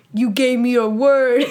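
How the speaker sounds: hammed-up angry shout; angry accusation. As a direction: imitate someone furious at being betrayed. Gangster beating someone in video game.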